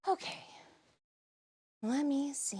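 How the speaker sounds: winded whisper; breathy whisper. Real, not acted. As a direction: whisper while catching breath.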